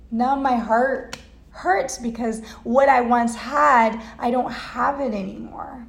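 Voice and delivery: ordinary talk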